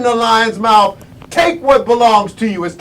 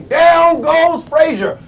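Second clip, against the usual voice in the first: high, slow ringside call; Howard Cosell; 5 semitones higher, slower than usual. Retold or imitated. Boxing commentator repeating the famous knockdown call, raised and drawn out. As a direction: stretch each word like a knockdown call. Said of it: "Howard Cosell".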